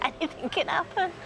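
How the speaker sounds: ordinary talk, nothing marked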